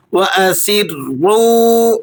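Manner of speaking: وَأَسِرُّوا with a thin whistled seen and heavy doubled ra; tajweed demo. Shown as a demonstration. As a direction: stretch وَأَسِرُّوا, hissing thin s, thick stressed rr. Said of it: "سین کو آپ نے باریک سیٹی کے ساتھ ادا کرنا ہے". (وَأَسِرُّوا میں سین باریک سیٹی کے ساتھ اور را پر اور سخت)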